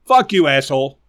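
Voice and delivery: usual speaking voice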